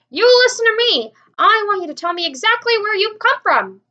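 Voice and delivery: loud, high stern shout; chief shouting. A narrator's reading. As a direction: shout through cupped hands. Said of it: "shouted the chief of police".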